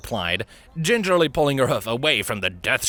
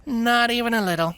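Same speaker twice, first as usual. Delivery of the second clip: deadpan; 12 semitones higher than usual. A narrator's reading. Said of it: "the Earth Pony deadpanned".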